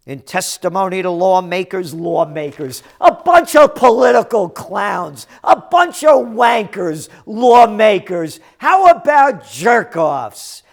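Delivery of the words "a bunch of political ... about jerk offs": shouted, higher and louder insults; angry shouting rant; furious rant. Real, not acted. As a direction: yell the insults with contempt. Ranting at lawmakers.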